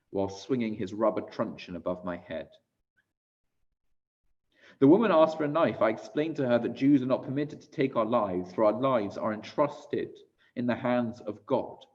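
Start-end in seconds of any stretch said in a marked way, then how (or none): none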